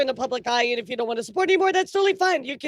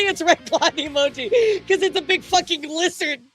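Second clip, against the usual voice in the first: usual speaking voice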